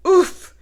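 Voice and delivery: a big comic oof as he trips. Acted. Reading to children, loud oof as pig trips.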